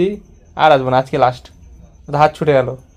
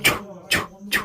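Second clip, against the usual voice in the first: breathy, whispered shoo-shoo; a soft whispered magic spell; whispered charm; 11 dB quieter than usual. Pretend. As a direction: whisper the charm over the sore hand. Blowing charm on child's hand.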